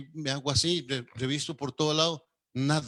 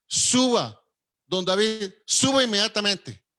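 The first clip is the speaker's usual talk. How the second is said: raised, higher, louder call to come up now; urgent summons; 5 semitones higher, 5 dB louder than usual. Retold or imitated. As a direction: bark the order to hurry upstairs. Secretary urgently summons him upstairs.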